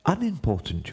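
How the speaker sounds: high, deferential correction. A narrator's reading. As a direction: correct the monarch politely, pitched high. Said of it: "in a very respectful tone".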